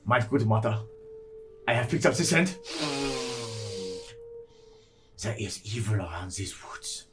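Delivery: German explorer dog voice. Acted. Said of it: "going into my mind palace and voices".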